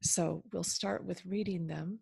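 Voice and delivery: usual speaking voice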